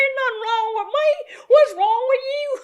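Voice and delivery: very high, comic country twang; put-on twang. Boss answering baffled staff, in comic high twang. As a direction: squeal it back in an exaggerated twang. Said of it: "start talking like this".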